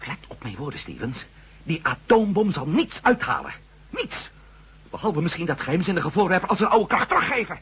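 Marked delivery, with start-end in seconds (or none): 1.6-7.6 higher-pitched, urgent warning; agitated warning. Acted. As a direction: press the danger home, pitch climbing. Heated warning about the bomb. (opgewonden waarschuwing)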